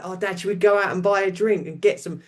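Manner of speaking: put-on high eager child voice asking Dad; bouncy child pleading for an outing; excited kid. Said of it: "they were like".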